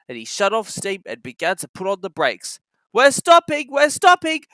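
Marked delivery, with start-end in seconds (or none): 2.9-4.4 higher, louder calling. A narrator's reading. Thomas calling to his trucks, higher and louder. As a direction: call back a warning. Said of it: "called Thomas".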